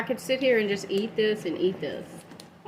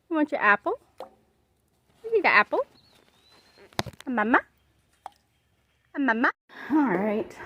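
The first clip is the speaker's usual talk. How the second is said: pet talk; 4 semitones higher, 3 dB louder than usual. Real, not acted. Offering apples to the dog.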